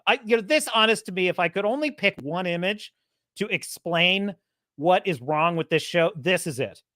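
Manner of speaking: normal talking voice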